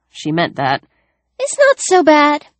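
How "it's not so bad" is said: light and breezy, a little higher; airily. A narrator's reading. Ellie brushing off gutting fish, airy and high. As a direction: toss it off like nothing. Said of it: "Ellie said airily".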